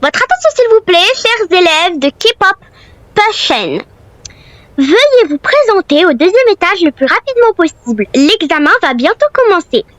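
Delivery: school PA announcement. Acted. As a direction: announce it over the school speakers. School headmistress announcement over PA.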